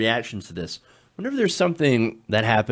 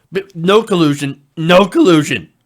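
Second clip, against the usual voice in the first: "no collusion" punched loudly, louder the second time; emphatic mock insistence; 3 semitones higher, 10 dB louder than usual. Host mocking Trump's repeated denial, loud and emphatic. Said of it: "He has to make sure to stress".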